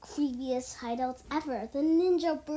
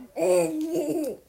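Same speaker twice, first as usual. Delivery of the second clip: laugh; 5 semitones higher, 9 dB louder than usual. Real, not acted. Laugh after noodles.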